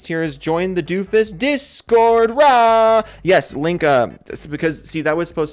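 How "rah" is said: a loud, high rah; loud high cheer; roaring rah. Real, not acted. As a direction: bark out a rah. Shouted cheer.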